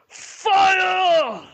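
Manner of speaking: long, very high, full-force yell; commander's battlefield scream; shouted command. Acted. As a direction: bellow the order to fire, stretching the word. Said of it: "Turok shout".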